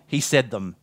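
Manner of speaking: plain reading voice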